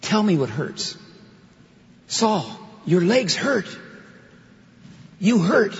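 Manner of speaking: compassionate voice of Christ. Acted. Christ pleads with Saul about his hurt, lightly voiced with compassion. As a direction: draw the hurt out of him with compassion. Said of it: "That's from this".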